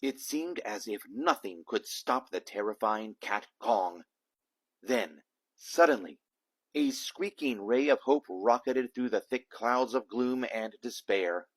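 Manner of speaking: ordinary delivery, nothing marked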